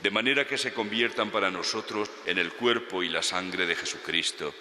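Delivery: ordinary talk, nothing marked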